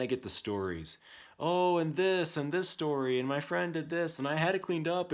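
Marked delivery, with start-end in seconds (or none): 1.4-5.1 high, loud run of whiny excuses; mock kid excuses. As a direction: imitate a child piling up excuses. Parent mimics son's excuses.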